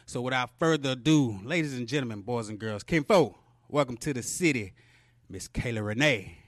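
loud hype-man introduction; grand entrance announcement. Real, not acted. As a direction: announce her like a show host. Host introduces guest on podcast.

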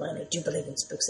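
hushed, eerie whisper; spooky whisper. Retold or imitated. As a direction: whisper it like a ghost story. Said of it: "I sound like the cowbell".

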